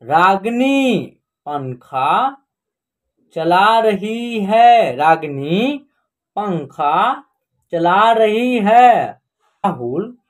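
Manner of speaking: slow word-by-word sentence reading; teacher reading slowly word by word; slow reading aloud. Shown as a demonstration. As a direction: read it out slowly for learners. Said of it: "हम direct पढ़ना सिखाएंगे आपको".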